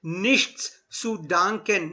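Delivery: slow Nichts zu danken, every sound; careful pronunciation demo; slow model phrase. Shown as a demonstration. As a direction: spell it out for the class. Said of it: "Try and pronounce every alphabet".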